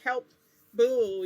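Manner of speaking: loud retold call for help; mock cry for help. Chased round the bed asking help for Boo, mock cry.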